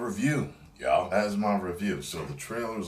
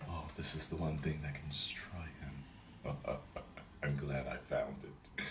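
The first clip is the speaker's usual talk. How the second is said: whispered, gloating villain; 4 semitones lower, 13 dB quieter than usual. Retold or imitated. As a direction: hiss it like a scheming villain.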